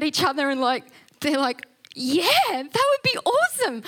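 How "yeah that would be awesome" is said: thrilled. Retold or imitated. Delighted ladies accept invitation.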